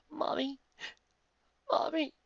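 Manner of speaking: put-on high, whimpering child's cry. A narrator's reading. Girl whimpering for her dead mother, high and tearful. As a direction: whimper it like a lost little girl. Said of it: "whimpering over".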